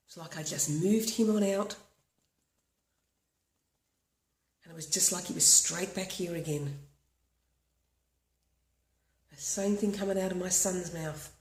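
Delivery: walk them through tapping calmly, line by line; guided tapping script. Acted. Leads a tapping routine.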